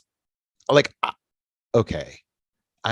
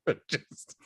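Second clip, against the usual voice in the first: laughing; 7 semitones higher, 8 dB quieter than usual. Real, not acted. Laughing whisper aside.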